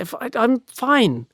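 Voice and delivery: high, flustered insistence; defensive denial. Acted. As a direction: brush them off, rattled. Bob insisting he's fine.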